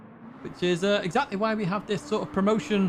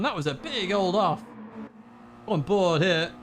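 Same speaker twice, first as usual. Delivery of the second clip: ordinary talk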